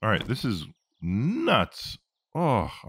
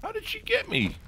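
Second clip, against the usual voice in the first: much higher squeal of disbelief; shocked shriek. Real, not acted. Player ambushed by an enemy, shocked high squeal.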